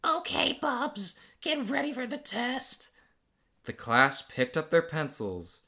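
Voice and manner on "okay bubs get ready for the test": high squeaky teacher voice. Acted. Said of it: "I need a good voice for her".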